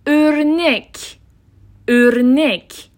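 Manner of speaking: "ارنک" very slowly as örnek; rounded Ö example. Shown as a demonstration. Teacher giving another example, "ارنک" slow with long ö. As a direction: open "ارنک" with eh through rounded lips. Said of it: "ولی از ته حنجره صدای".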